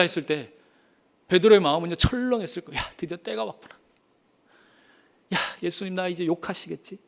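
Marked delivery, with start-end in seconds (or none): 2.8-7.0 soft, worried muttering to himself; anxious inner thought. Acted. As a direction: think it fearfully under your breath. Peter's fearful inner voice. (조용히 걱정스럽게 혼잣말하듯)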